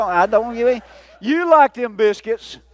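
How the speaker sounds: normal talking voice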